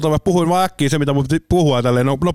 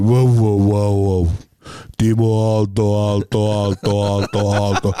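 lower, slow, each word fading like a hall echo; arena echo; 7 semitones lower, much slower than usual. Retold or imitated. Announcer hearing his own echo in the arena, fading repeats. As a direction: mimic your voice bouncing round the rink. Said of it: "Sä kuulet se oma ääni silleen".

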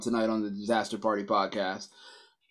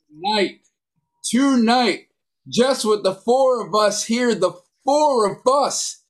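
loud, high hype intro; hype host; 4 semitones higher, 4 dB louder than usual. Acted. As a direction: pump up the crowd like an MC.

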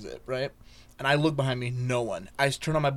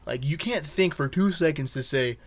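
ordinary talk

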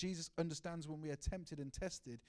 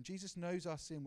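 usual speaking voice

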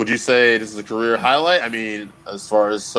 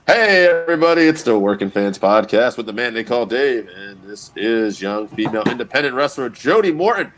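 radio voice. Acted. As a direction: boom it out like a radio DJ. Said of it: "I had such a radio voice going".

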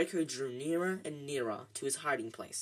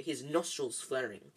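ordinary delivery

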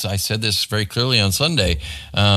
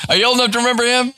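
laughing; 12 semitones higher, much faster than usual. Real, not acted. Teasing an older listener.

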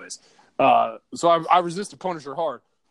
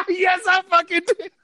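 laughing admission; 9 dB louder than usual. Real, not acted.

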